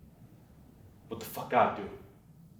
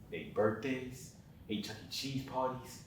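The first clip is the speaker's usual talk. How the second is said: slow and much softer, heavy with regret; quiet grief; 12 dB quieter than usual. Acted. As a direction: count them out in sorrow. Grieving father's monologue.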